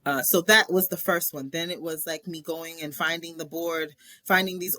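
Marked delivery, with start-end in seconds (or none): none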